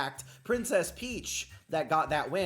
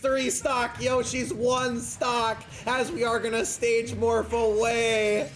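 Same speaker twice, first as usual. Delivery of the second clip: raised, urgent sports-commentator voice; excited play-by-play; 6 semitones higher, 5 dB louder than usual. Real, not acted. Commentator urging on a passive Yoshi, excited play-by-play. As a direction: call the fight live, building to louder exclamations.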